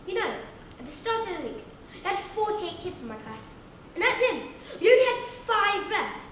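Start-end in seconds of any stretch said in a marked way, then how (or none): none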